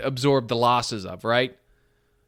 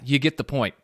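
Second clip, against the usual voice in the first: ordinary talk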